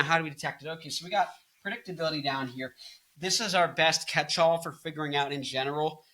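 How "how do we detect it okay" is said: ordinary delivery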